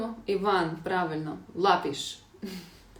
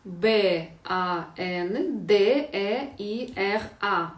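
«Б А Н Д Е І Р А» повільно, кожна літера окремо; проказуй літери рівно і з паузами; повільна диктовка; slower than usual. Shown as a demonstration. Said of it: "Наступне слово". (вчителька диктує наступне слово учням)

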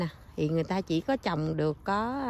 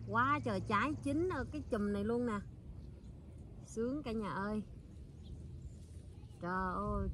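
quiet; 2 semitones higher, 7 dB quieter than usual. Real, not acted. Picking, speaking softly.

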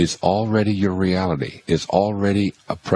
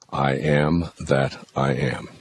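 slow, solemn words of God; slow mantra; 3 semitones lower, slower than usual. Shown as a demonstration. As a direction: draw out each word reverently. God's name quoted slowly.

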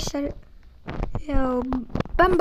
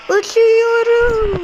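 higher, louder, very slow drawn-out shout; excited flying cry; 6 semitones higher, 5 dB louder, much slower than usual. Real, not acted. Player whose car takes off, drawn-out excited cry. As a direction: stretch it out, thrilled to be airborne.

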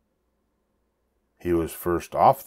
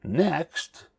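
crowing; 9 semitones higher, 4 dB quieter than usual. A narrator's reading. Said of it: "she crowed".

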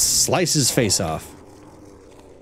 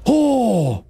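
excited; 10 semitones higher than usual. Real, not acted. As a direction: gush with real delight. Amazed by slow-motion effect.

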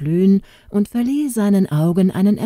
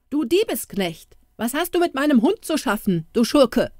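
higher, fast, outraged scolding; indignant outburst; 8 semitones higher than usual. A narrator's reading. Poultry dealer scolding the boy over his dog, outraged. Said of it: "rief er empört".

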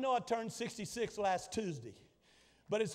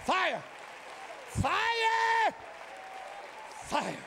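shouted fire; 11 semitones higher, much slower than usual. Acted. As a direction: call out fire to the congregation. Sermon climax on fire.

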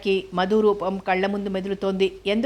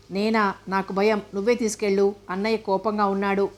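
usual speaking voice

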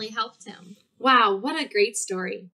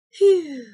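relieved phew; 5 semitones higher, 7 dB louder than usual. Acted. Relief after counting to ten.

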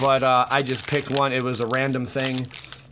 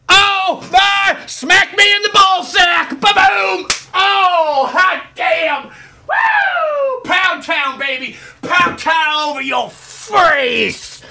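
very high, very loud screaming celebration; ecstatic yelling; 9 dB louder, much slower than usual. Real, not acted. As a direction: lose it completely over the card. Wild reaction to a big pull.

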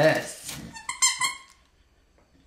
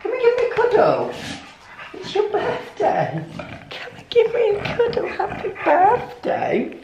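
much higher cooing voice for the dog; 12 semitones higher than usual. Real, not acted. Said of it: "talk to their dogs like a baby".

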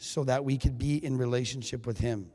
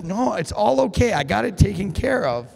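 forced cheery insistence; 7 semitones higher, 4 dB louder than usual. Retold or imitated. Husband insisting he's fine.

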